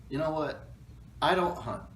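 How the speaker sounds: usual speaking voice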